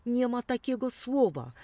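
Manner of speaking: high, loud objection; indignant denial. A narrator's reading. Mouse and sparrow objecting to the mower's made-up word, high and loud. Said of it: "zgodnie zaprzeczyli myszka".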